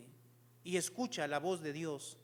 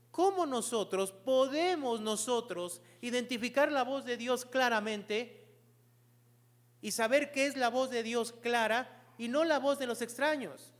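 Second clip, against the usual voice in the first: raised, higher-pitched sermon voice; forceful preaching; 6 semitones higher, 7 dB louder than usual. Real, not acted. Preacher urging congregation to know God's voice, forceful preaching. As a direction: press the congregation with urgent, emphatic points.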